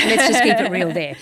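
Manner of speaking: bursts out laughing mid-sentence. Real, not acted. Admitting family is her trauma.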